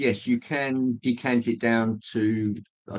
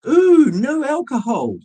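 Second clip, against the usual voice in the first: high, slow, simpering sales voice; mocking advert voice; 14 semitones higher, 7 dB louder, slower than usual. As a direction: coo it like a prissy gel label. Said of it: "fancy hand gels saying".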